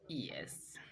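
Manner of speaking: ordinary delivery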